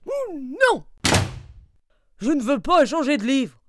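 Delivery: the bear has had enough; loud fed-up bear protest; exasperated bear. Acted.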